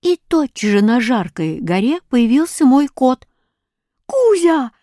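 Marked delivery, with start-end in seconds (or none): 4.1-4.7 high, delighted call to a pet; joyful high kitty-calling; calling cat. A narrator's reading. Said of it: "позвал я кота".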